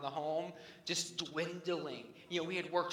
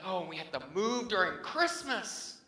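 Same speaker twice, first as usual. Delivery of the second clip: loud, higher exasperated cry; frustrated outburst; 8 semitones higher, 7 dB louder than usual. Retold or imitated. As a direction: throw up your hands in mock despair. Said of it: "and I was like".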